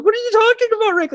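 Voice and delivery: very high-pitched panicky kid impression; mock bewildered protest, voice shooting up; Morty. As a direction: squeal it like a flustered cartoon sidekick. Acting out Morty's panicked question.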